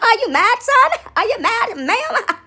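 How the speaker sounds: loud, high teasing questions; mock taunt. Taunting critics.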